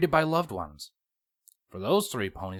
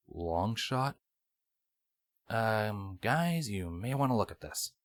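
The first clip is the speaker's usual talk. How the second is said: hushed warning; 5 dB quieter, slower than usual. Acted. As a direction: warn them quietly. Longshot alarmed, quietly.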